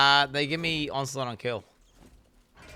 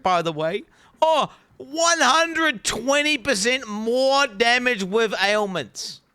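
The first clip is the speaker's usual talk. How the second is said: high, loud, slow listing of each stat; incredulous excitement; 11 semitones higher, 5 dB louder, slower than usual. Real, not acted. Streamer reading a broken item to chat, excited disbelief. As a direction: hype up every number.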